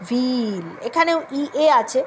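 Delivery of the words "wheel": wheel with a shorter ee. Shown as a demonstration. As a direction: say wheel, less stretched. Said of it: "এতটা elongated না". (ছোট ই দিয়ে wheel)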